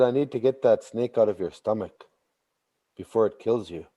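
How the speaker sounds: soft low warning; quiet. Acted. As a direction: hush it like a secret. Little woman speaks to Baba Mosh.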